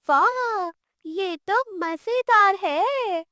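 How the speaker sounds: put-on high, slow, thrilled child's cheer; a boy marvelling at a trick; delighted wow. Boy clapping for the witch's magic, high and delighted.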